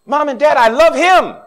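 a hint of a lovesick teenager; lovestruck kid. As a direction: play the smitten young lover, hammed up. Mocking young person in love.

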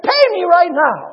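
loud, high shouted order; forceful hammed-up yell; acted angry demand. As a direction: demand it as a furious creditor. Creditor choking debtor, demanding money.

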